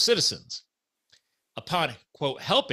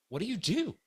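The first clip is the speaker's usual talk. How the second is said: high, softer baffled question; retold amazement; 9 semitones higher, 5 dB quieter than usual. Asking the volunteer his job, retold amazement. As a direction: ask it in mock bewilderment.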